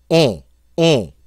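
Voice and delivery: "on" as bone cut before N; nasal vowel. Shown as a demonstration. Teacher isolates the French sound, nasal vowel. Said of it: "That's the sound we're looking for".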